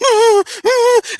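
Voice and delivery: very high whining whimper. Retold or imitated. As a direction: whimper like a scared child. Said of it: "he's whimpering as he's doing it".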